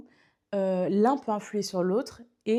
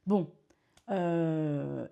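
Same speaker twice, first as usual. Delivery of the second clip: usual speaking voice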